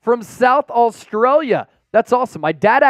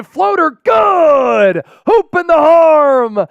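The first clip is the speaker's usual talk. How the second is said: high, drawn-out shouted call; excited play-by-play; 7 semitones higher, 6 dB louder, slower than usual. Real, not acted. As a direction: shout the basket, stretching the big words. Live call of a made basket.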